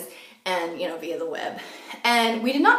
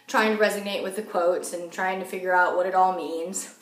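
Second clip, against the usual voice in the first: normal talking voice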